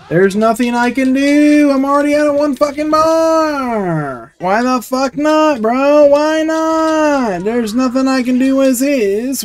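high, drawn-out wail; exasperated high-pitched gamer lament; helpless frustration. Real, not acted. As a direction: whine it out in long sustained cries. Losing, wailing at the game.

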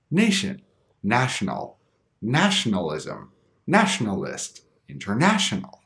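"Nation, national, nationalism, nationalist, international" all stressed on NA; same syllable stressed. Shown as a demonstration. Teacher adding suffixes, "Nation" to "international" keeping stress on NA. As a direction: keep the punch on NA from "Nation" through "international". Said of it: "stress remains on the same syllable".

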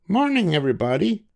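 high, cheerful storybook voice for Amelia; Amelia Bedelia voice. A narrator's reading. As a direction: lift into a bright girlish character voice.

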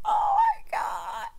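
very high, slow squeal through laughter; helpless laughter. Real, not acted. Recovering from a husband's funny quote, helpless high laughing. Breathless after laughing hard.

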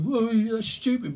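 high, drawn-out mocking naysayer; slow exaggerated scoff. Imitating someone scoffing at plans.

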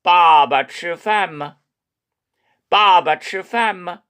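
빠바 치饭吗 very slowly, light 吗 at the end; 爸爸吃饭吗 慢读; slow Chinese question. Shown as a demonstration. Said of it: "약하게 맞자 붙이면 의문이".

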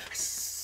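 quiet hiss; snake hiss. Retold or imitated. Imitating snake.

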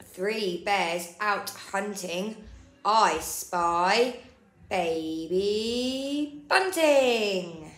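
ordinary delivery, nothing marked

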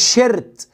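"شيرت" with an Arabic-style vowel and R; mispronounced R. Shown as a demonstration. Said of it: "ما تقولش شيرت".

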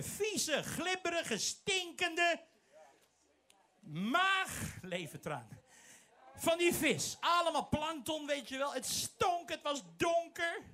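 high, building preacher voice describing the filthy fish belly; vivid sermon description. Acted. Preacher to congregation, high vivid storytelling. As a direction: paint the stinking darkness, voice climbing.

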